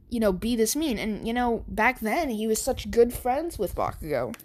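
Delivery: ordinary talk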